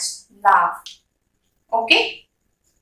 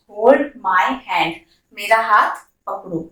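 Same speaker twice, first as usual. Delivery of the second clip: ordinary talk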